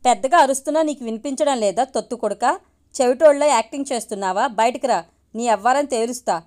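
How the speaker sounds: plain reading voice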